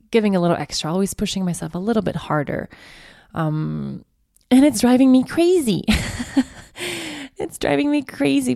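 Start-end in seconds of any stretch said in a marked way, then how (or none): 4.5-8.6 exasperated. Real, not acted. Speaker venting about always being the giver, exasperated. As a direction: let the frustration burst out. Venting frustration with giving too much.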